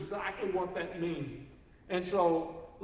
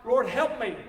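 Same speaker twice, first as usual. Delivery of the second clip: loud desperate plea, pitched up; crying out; 5 semitones higher, 7 dB louder than usual. A narrator's reading. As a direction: cry out to the Lord for help. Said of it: "the Bible says she screams out".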